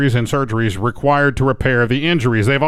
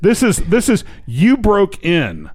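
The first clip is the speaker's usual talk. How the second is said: high, slow, exasperated emphasis; indignant; 6 semitones higher, slower than usual. Real, not acted. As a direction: spell it out like it's obvious. Indignant at trespassing plaintiffs.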